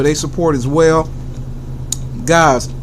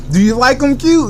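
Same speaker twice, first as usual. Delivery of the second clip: higher interviewer voice asking himself; high questioning voice; 9 semitones higher than usual. Pretend. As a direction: play the interviewer, pitched up.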